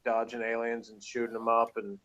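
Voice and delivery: ordinary talk, nothing marked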